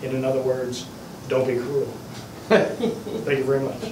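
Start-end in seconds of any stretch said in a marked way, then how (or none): 2.5-3.8 [name] voice. Pretend. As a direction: lightly do the King's catchphrase. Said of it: "getting rehitched by the king".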